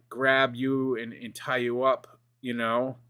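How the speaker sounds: usual speaking voice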